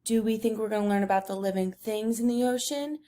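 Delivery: ordinary talk, nothing marked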